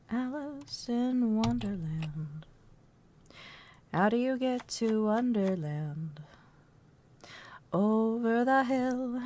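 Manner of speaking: soft, slow dreamy storybook voice; Alice in Wonderland. Pretend. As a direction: hum and drift like Alice lost in Wonderland. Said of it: "Mama looks more like Alice in Wonderland".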